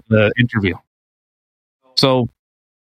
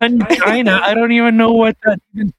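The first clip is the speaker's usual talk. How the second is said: laughing; 8 semitones higher than usual. Real, not acted. Baffled by the word, laughing.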